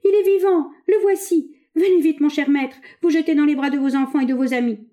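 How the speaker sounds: high, loud jubilant call; exultant crying out. A narrator's reading. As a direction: call out joyfully to the master. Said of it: "s'écriait le bossu".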